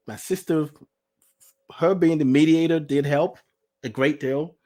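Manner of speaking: ordinary delivery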